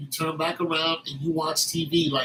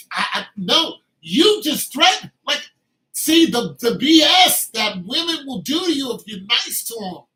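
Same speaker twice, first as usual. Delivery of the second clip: high, loud outraged rant; a loud, high indignant outburst; 4 semitones higher, 8 dB louder than usual. Real, not acted. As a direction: rise up in outrage. Indignant rant about women.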